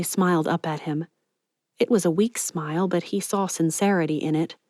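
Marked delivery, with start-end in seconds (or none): none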